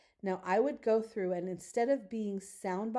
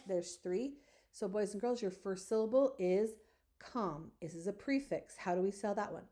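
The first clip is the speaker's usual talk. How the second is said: ordinary talk, nothing marked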